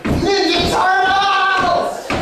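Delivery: long drawn-out high call down a manhole. Retold or imitated. Stoned guy calling into a manhole, long drawn-out call. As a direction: call the turtles out, stretched long.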